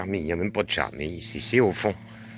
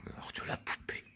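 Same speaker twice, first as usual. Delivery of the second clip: whisper; 17 dB quieter than usual. Pretend. Repeats the title mockingly.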